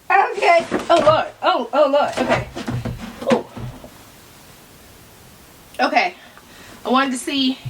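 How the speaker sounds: ordinary delivery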